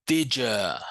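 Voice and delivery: "Did ya" as "didja", with a j sound; reduced did ya. Shown as a demonstration. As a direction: melt "Did ya" into one word, didja. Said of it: "vamos falar did ya".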